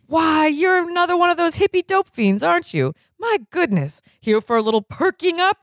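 gleeful high-pitched quack; high, giddy doctor. Acted. Blatnoyd excited about dope.

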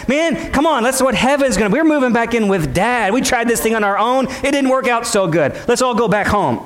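rally the congregation with a lifted pitch; rallying preaching. Acted. Rallies congregation with fervor.

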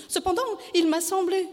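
high-pitched, agitated probing; anxious wife. A narrator's reading.

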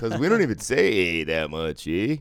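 loud mock-Canadian protest; Canadian accent. Canadian fans objecting about slang, in a mock Canadian accent. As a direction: play up the Canadian lilt, loudly. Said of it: "That's how you do a good accent".

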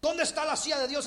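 indignant repeat; angry Job. Acted. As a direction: Job demanding God's throne. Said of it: "Está enojado".

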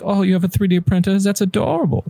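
high-pitched, lightly put-on German accent; condescending quote in a hint of German accent; mock German accent. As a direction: imitate a bragging maker, slightly German. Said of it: "the accent always goes German".

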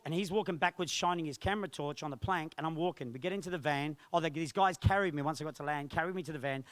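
normal talking voice

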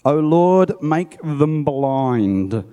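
Elisha calls on God against the army, slow; solemn prayer. A narrator's reading.

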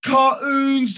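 ordinary talk, nothing marked

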